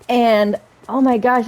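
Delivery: normal talking voice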